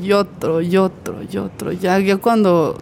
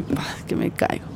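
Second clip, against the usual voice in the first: ordinary delivery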